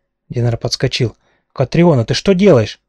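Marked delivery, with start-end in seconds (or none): 1.5-2.8 startled cry of her name; alarmed man shouting her name. Acted. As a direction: call out in alarmed surprise. Alarmed at attack with stick.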